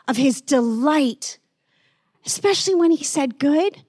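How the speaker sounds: normal talking voice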